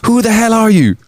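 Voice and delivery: high-pitched Irish demand; startled demand. Acted. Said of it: "staggered back and demanded".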